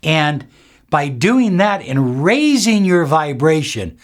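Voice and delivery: ordinary delivery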